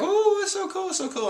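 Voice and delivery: very high, squealing excitement; gushing crew. Retold or imitated. Crew seeing the giant undersea waterfall, squealing high. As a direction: squeal at the sight. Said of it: "they're all like".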